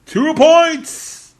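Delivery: high, loud hype announcer call; fighting game announcer. Acted. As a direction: boom it out like an arena intro. Announcer take after plain.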